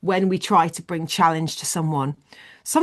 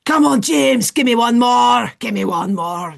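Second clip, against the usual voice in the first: loud, drawn-out Scottish gym shout; Scottish accent; 4 semitones higher, 6 dB louder than usual. Acted. Scottish guy pushing James for another rep, shouted Scottish accent. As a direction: urge him on hard in broad Scottish. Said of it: "and the guy's like".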